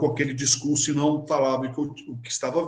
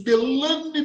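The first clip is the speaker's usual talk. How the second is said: name swooping high and drawn out; theatrical astonished call; 9 semitones higher than usual. Retold or imitated. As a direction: imitate her dramatic "Delane!". Repeats her dramatic discourse. (chamado teatral de espanto)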